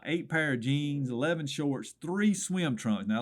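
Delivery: ordinary delivery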